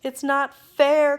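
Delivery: high, loud, slow wail; whining tantrum. Acted. Duke wailing at the king, slow loud tantrum. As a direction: wail it like a spoiled child. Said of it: "cried the Grand Duke".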